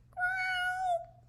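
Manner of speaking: tiny high squeak of a growl; a caterpillar trying to roar and squeaking; squeaky little growl. A narrator's reading. Caterpillar practicing his roar, squeaky growl. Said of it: "very squeaky little growl".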